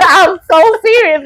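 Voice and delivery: high, laughing insistence. Real, not acted. Mom swearing to co-host she means it, laughing. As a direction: insist through real laughter. Laughing after her own retold crying.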